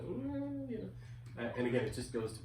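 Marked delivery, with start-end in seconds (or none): 0.0-0.7 a much higher, unsure hum; mock hesitant hum. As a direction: hum like nobody knows what's going on. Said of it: "everything is kinda like".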